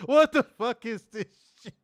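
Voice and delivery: high, loud, laughing outrage; outraged retold yell. Host retelling his reaction to the belt, laughing outrage. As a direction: blurt it out in laughing disbelief. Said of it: "And I said".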